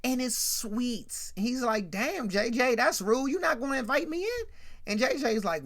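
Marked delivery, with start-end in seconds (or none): 1.9-4.4 higher-pitched mock offense; affronted. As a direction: act the snubbed guest at the door. Sweets offended at the door.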